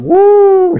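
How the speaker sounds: high, loud imitated fan whoop; crowd cheer. Imitating the concert crowd cheering as the lights go out, high loud whoop. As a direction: whoop like a screaming fan at the show start. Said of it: "Sisigaw na kagad".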